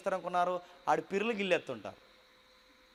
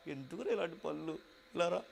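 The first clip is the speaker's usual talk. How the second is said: mild weeping mother; 10 dB quieter than usual. Retold or imitated. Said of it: "అని ఏడుస్తుంది".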